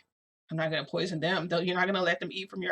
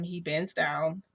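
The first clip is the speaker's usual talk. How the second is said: usual speaking voice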